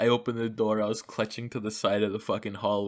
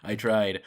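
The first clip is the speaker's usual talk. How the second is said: "tried" leaned on alone; stress on tried. Shown as a demonstration. Friend asks for emphasis, so "tried" stressed. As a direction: punch the word "tried". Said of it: "Emphasis on try".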